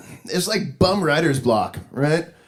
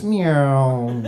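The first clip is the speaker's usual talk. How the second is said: long "meow" sliding downward; drawn-out, descending mournful cat cry; falling sad cat meow; 4 semitones lower than usual. Retold or imitated. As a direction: stretch the meow out as the cat drops away. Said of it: "sound of a sad cat falling from a window".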